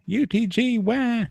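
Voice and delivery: high mock whine, crying it out; high mocking wail; mocking crybaby wail. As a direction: squeal it like a whiny baby. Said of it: "run screaming, cry like bitches".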